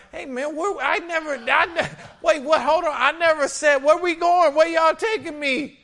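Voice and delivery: high, sputtering voice of an alarmed passenger; panicked mock protest. Sick man on a mat to his carriers, high mock protest. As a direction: imitate a baffled man objecting in a high-pitched voice.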